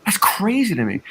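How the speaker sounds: high-pitched mock amazement; facetious disbelief. Panelist pretending racism is news to him, facetious. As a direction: feign amazement with the pitch pushed up. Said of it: "that was me being facetious the entire time".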